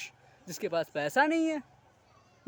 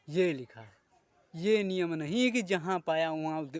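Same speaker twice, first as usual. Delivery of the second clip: normal talking voice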